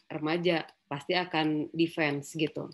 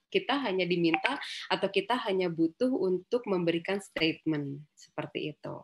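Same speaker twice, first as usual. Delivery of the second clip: normal talking voice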